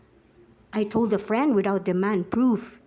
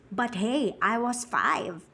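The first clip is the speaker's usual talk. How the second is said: ordinary delivery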